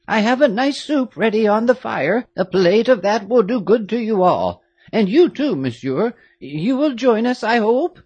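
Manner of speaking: put-on a doting old servant, amazed; old woman greeting the girls; old nurse. A narrator's reading. Old nurse Louise voiced.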